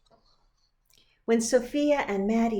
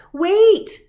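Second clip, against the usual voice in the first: loud high call after her; child yelling wait; 12 semitones higher, 14 dB louder than usual. Acted. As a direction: shout it after the running girl. Girl calling after her running friend.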